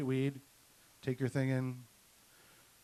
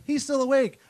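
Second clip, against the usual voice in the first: nurse's alarmed warning; 9 semitones higher, 7 dB louder than usual. Retold or imitated. Nurse warns doctor mid-procedure.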